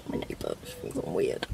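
real unvoiced whisper. Real, not acted. Girl whispering to camera about watching neighbour. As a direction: whisper it so the neighbour can't hear.

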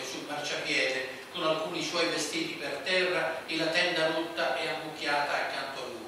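usual speaking voice

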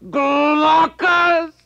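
high, loud cry for a comrade; calling out. Acted. Dying Sarpedon calls to Glaucus, a high ringing cry. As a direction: call his friend's name across the battle. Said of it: "and calling".

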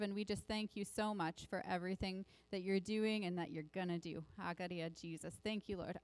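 quiet, earnest prayer voice. Acted. Pastor praying over the church, hushed prayer. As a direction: pray softly with eyes closed. Opening prayer before sermon.

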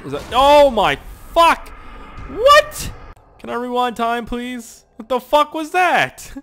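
high, loud shocked yelps; real disbelief, yelling what; shocked outburst. Real, not acted. Sudden shock at car event.

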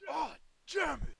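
high yelled curse; shouting. Acted. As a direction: yell it in frustration. Frustrated curse in the episode.

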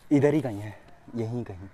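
normal talking voice

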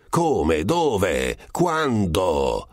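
ordinary delivery, nothing marked

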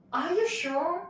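mock-shocked listener; incredulous reaction. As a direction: act taken aback by what you just heard. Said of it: "みたいな感じに、".

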